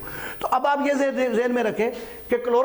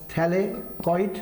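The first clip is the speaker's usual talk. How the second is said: thylakoid stretched very slowly, t̪ʰe-le-koid; teacher dictating thylakoid slowly; slow spelled-out term; much slower than usual. Shown as a demonstration. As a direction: drag out thylakoid so students catch it. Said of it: "thylakoid membranes".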